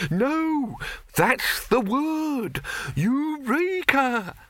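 high, slow, dreamy exclamation; dazed alchemist. A narrator's reading.

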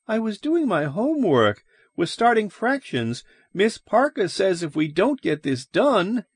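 high-pitched schoolboy voice; young boy, protesting. A narrator's reading. Son explaining his unfinished fractions homework, high boyish protest. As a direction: plead your case in a high child's voice.

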